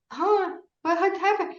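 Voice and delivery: very high-pitched retold surprise; high, incredulous imitation of a baffled man; mock bafflement. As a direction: act astonished, voice shot way up. Retold businessman baffled by parrot's escape.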